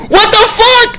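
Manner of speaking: squeaky-high mock disbelief; acted shock. Vlogger reacting to his own discovery, shocked. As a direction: shoot way up in pitch, incredulous.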